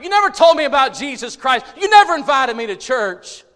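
high, loud shouted reproach; anguished accusation. Acted. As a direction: hurl each charge as the condemned neighbor. Preacher voices damned neighbor's accusation.

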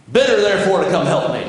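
mildly bossy Martha voice; demanding. Acted. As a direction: press the Lord like an impatient hostess. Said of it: "she can be a little bit demanding".

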